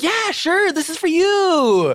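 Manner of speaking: very high, drawn-out mock agreement; exaggerated high-pitched obliging voice; mock-sweet falsetto. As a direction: sing it out sky-high, stretching the last word. Acts out granting braver space.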